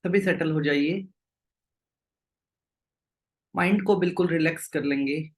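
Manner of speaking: low, unhurried meditation-guide voice with long pauses; guided meditation. Real, not acted. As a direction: settle the group gently, leaving long silences. Leads group into meditation.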